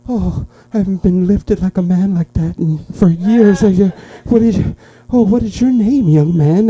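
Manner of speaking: put-on high-pitched, excited old lady voice; elderly lady. Elderly lady to worker who lifted her, excited. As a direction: impersonate a thrilled elderly lady. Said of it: "there was an elderly lady behind me".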